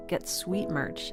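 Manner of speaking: normal talking voice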